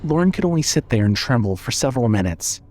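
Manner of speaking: usual speaking voice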